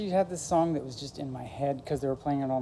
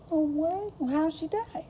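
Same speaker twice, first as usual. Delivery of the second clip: high, louder startled questions; imitated gasping classmates; 13 semitones higher, 5 dB louder than usual. Classmates hearing the rumour, high startled imitation.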